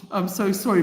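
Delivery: ordinary talk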